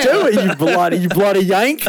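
hammy fake British accent, jeering; over-the-top British accent mocking a yank. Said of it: "you actually got a pretty good British voice".

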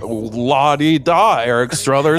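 slow, high sing-song mockery; mocking la-di-da. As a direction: drawl it out like a snob. Mocking a fancy name.

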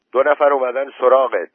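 loud, high calling voice. A narrator's reading. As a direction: raise your voice to get through to him. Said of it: "با صدای بلندتر گفت". (با صدای خیلی بلند)

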